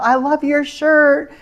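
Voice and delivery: put-on high, slow old lady compliment; a sweet little old lady, slow and high. Old lady compliments her shirt.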